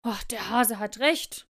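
slow, lightly grumbled concession; a hint of a growly wolf; growling wolf. A narrator's reading. Wolf conceding to the hare, mild slow grumble. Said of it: "knurrte der Wolf".

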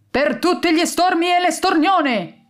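high mock rallying cry; croaked battle cry. A narrator's reading. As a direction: bellow it like a bird commander. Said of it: "gracchiò con tutto il fiato". (grido gracchiante e acuto)

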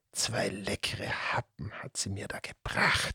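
soft, sly creature whisper; sly grinning whisper. Acted. The serpent to the two children, a sly hungry whisper. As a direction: purr it like a cat eyeing prey. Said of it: "mit einem hinterlistigen Grinsen".

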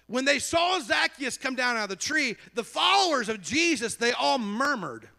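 high, declaimed Bible narration. Real, not acted. Preacher telling of the murmuring followers, high declamation. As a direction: proclaim it, drawing out the final words. Preacher reads scripture at force.

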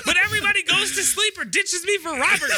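high-pitched comic grievance breaking into laughter; aggrieved complaint. Real, not acted. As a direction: wail about being ditched, half laughing. Comic complaint about being ditched.

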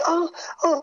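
very high hammed-up moans; high imitated moaning; mock moaning. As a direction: squeal out two mock moans.

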